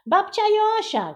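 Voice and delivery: very high, loud blurted answer; boy shouting the answer. A narrator's reading. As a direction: blurt it out first. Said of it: "krzyknął Kuba".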